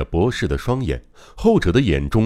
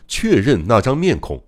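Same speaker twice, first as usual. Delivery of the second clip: ordinary delivery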